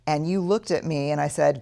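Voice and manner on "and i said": ordinary talk, nothing marked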